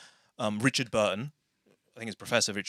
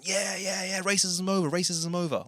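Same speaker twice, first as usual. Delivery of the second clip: mock celebration; 8 semitones higher than usual. Mimicking everyone on the news after Obama's election, mock jubilant. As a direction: imitate a jubilant crowd, pitched well up.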